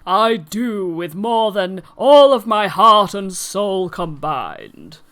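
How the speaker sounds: slow, heartfelt vow; earnest groom's vow. Acted. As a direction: linger on every word of the promise. Candlefoot's wedding vows.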